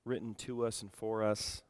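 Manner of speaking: soft, subdued prayer to the Father. Real, not acted. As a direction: pray quietly and humbly, with reflective pauses. Leads the congregation in prayer.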